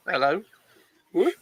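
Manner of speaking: ordinary talk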